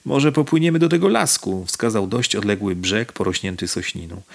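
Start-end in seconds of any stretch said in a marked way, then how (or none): 0.0-1.6 higher, livelier change of tone; different tone. Acted. Nikodem changes the subject with Nina, higher lighter tone. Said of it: "dodał innym tonem".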